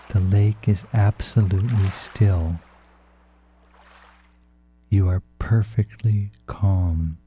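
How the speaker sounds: ordinary talk, nothing marked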